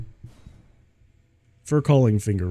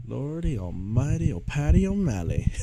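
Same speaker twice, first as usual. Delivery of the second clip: lilting, higher goofy outcry; sing-song exclamation; 5 semitones higher than usual. Pretend. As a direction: sing it out like a silly cartoon.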